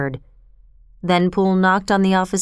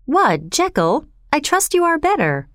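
calling out; 7 semitones higher than usual. A narrator's reading. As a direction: call up to the window, rising high. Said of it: "cried out to him".

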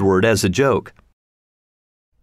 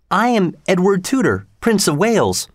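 put-on proud young prince; 8 semitones higher than usual. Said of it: "answered the prince".

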